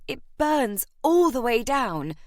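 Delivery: indignant complaint. Acted. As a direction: complain that it scorches her throat. Maddie complains about the brandy.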